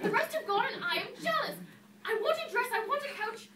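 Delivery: high, loud, spoilt demands; jealous, pushy wish list; petulant demanding. Acted. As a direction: reel off your wants insistently. Cinderella demands things from fairy.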